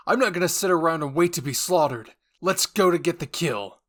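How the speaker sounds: very loud defiant shout; rally them with a roar; Jean shouting defiance to rally the squad, very loud; yelling. Acted. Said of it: "Jean yelled out".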